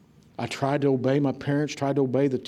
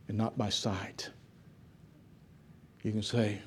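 hushed preaching appeal; 2 semitones lower than usual. Real, not acted. Preacher to doubters in the church, hushed appeal. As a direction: bring it right down and let each phrase settle.

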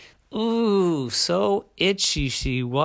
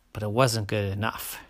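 ordinary delivery

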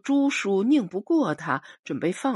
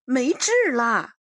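higher and a bit louder, called up to the window; girl calling up to grandma at the window, a touch higher and louder; 6 semitones higher, 4 dB louder than usual. A narrator's reading. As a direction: shout the praise back to grandma. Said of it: "朱秀秀喊".

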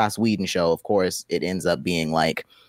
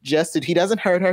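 ordinary delivery

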